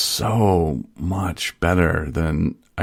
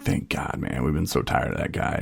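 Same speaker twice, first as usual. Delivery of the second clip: much softer, near-whispered relief; hushed thanks; 5 semitones lower, 9 dB quieter than usual. Retold or imitated. Classmates thanking him after group, hushed and relieved. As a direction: breathe it out like a secret thank-you.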